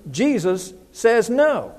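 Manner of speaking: no drawn out slowly at the end; firm no. Shown as a demonstration. Preacher insists Jesus refuses, slow firm no. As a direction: lean on no and hold it. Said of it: "you ought to know".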